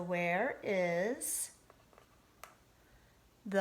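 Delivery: very slow, pausing between words; slow spaced words. Shown as a demonstration. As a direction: show the finger spaces by spacing words. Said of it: "just one".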